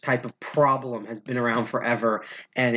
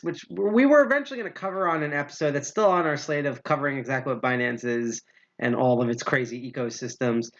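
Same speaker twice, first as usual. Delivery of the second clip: ordinary talk, nothing marked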